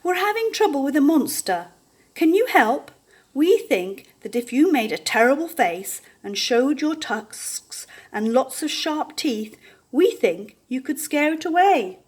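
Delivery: a polite small animal asking a favour; porcupine voice. A narrator's reading. Porcupine asks the warthog for help, pleading.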